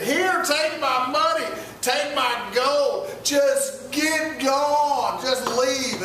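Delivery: high, drawn-out pleading; slow, begging them to go; desperate Egyptians. Retold or imitated. Egyptians handing over gold, slow desperate plea.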